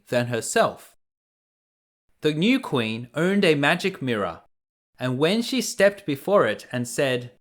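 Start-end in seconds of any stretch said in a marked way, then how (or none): none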